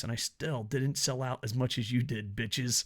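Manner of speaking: ordinary talk, nothing marked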